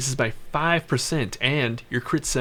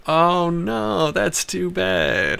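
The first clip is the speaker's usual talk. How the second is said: mock sympathy; 6 semitones higher, much slower than usual.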